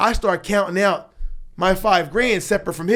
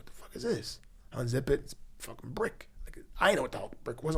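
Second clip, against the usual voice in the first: breathless stunned whisper; hushed whisper; 13 dB quieter than usual. Retold or imitated. Shocked whisper as he opens the bag of bricks.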